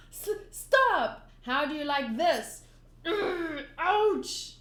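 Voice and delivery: loud, high protests and yelps. Acted. Clown fighting back, loud high yelps. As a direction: cry out stop under attack.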